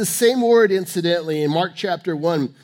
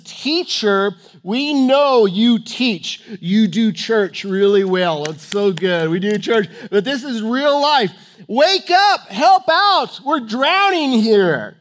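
impatient, scolding yell for help; exasperated disciples; 2 semitones higher, 6 dB louder, slower than usual. Acted. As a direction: berate a teacher who won't help. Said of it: "that's the attitude behind this".

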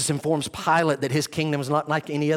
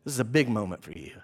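low, soft and grave; quiet, low-pitched weighty aside; hushed repeat; 9 semitones lower, 6 dB quieter, faster than usual. Acted. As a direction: drop right down and let it sink in. Same line repeated, hushed.

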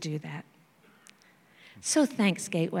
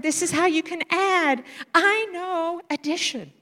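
high, proud teaching voice; a kid showing off his new skill; 9 semitones higher than usual. Retold or imitated. Boy showing on the board.

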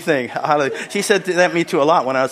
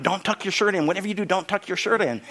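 higher, softer imitated wife; wife's instruction; 5 semitones higher, 5 dB quieter than usual. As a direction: lightly mimic a wife's insistent advice. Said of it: "She was telling me this morning".

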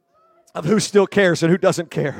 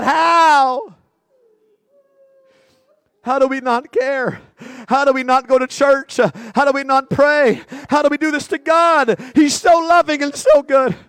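impassioned preaching; 4 semitones higher than usual. Acted. Impassioned pleading sermon.

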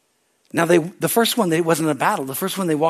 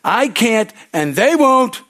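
high, slow, drawn-out whine; slow whiny excuse; 7 semitones higher, 5 dB louder, much slower than usual. Retold or imitated. Believers excusing themselves to the Savior, slow whine. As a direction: stretch out the excuse, pitched up. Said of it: "we're like".

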